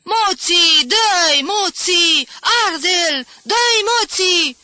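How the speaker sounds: very high, loud fan's yell; wild ringside screaming, words stretched out; unrestrained howling. A narrator's reading. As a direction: howl the cheers with abandon. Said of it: "Bobby urlă dezlănțuit".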